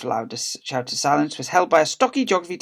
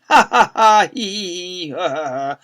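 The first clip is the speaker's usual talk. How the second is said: acted whole-school laughter; varied laughs, loud and high then falling; uproarious laughter; 5 semitones higher, 8 dB louder, slower than usual. A narrator's reading. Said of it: "The whole school laughed uproariously".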